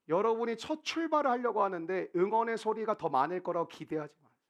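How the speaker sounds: ordinary delivery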